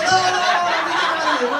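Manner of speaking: high, loud flustered no; loud denial. Retold or imitated. Comic himself shooing away fans. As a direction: wave off the fans, loudly. Denying celebrity loudly.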